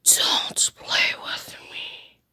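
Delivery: slow menacing whisper; threatening whisper. Acted. Threat after being laughed at.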